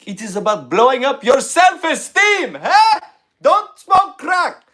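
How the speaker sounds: put-on high, loud Middle Eastern accent; hammed-up Middle Eastern motivational speaker; terrorist motivational speaker. Comic telling the podcast host about his old stand-up bit, in a loud Middle Eastern accent. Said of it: "I did a character ... Muhammad Akhshidad".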